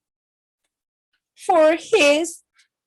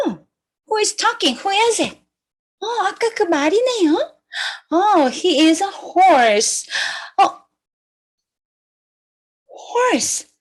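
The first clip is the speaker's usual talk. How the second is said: ordinary delivery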